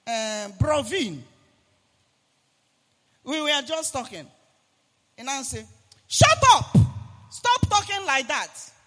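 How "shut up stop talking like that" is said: sharp shouted rebuke, high and loud; angry shout. Retold or imitated. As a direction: bark it at a friend who talks too much. Acted angry quote to Brovin.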